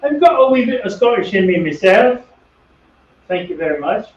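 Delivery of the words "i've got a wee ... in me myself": put-on a light, louder Scottish accent, a bit higher. Joking to partner about being part Scottish, lightly Scottish accent. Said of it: "that's about the only one I can do".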